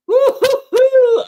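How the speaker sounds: long high loud whoop; hype whoop. Real, not acted. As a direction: whoop in slow bursts.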